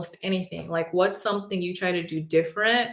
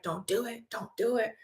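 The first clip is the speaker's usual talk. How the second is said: much softer, pleading don't do it; hushed inner warning; 3 semitones higher, 7 dB quieter than usual. Retold or imitated. The calm part of her brain holding her back, hushed plea. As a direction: quietly talk yourself down. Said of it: "her brain's like".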